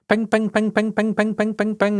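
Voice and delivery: high, nasal-free sing-song pings imitating notifications; mock phone pings. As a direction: chirp ping ping like a phone buzzing with messages.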